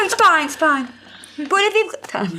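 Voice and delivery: high mock-mum voice through laughter; impression of mum. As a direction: play your mum coaxing while giggling. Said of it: "I love your impression of your mum".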